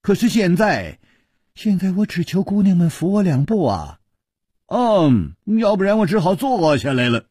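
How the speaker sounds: high-pitched, rueful pleading; wry smile. A narrator's reading. Chu Liuxiang begging girls for support, wry smile. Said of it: "楚留香苦笑着说".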